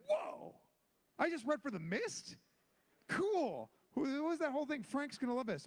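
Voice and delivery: high, stunned whoa and rising wonder; amazed. Retold or imitated. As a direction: gasp it as the news sinks in. Realizes he read for The Mist.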